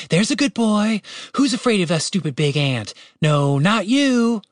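high-pitched doggy-praise voice; high, playful pet talk; praising a pet. A narrator's reading. Catherine praising her pet wolf.

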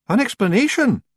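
high Scots question, taken aback; high-pitched, uneasy Feagle voice. A narrator's reading. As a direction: echo it uneasily as a wee Scotsman. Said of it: "shifting uneasily".

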